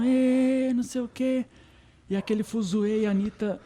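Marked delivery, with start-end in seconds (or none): 0.0-1.4 high, loud mock cheer; crowd cheering. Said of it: "todo mundo gritando".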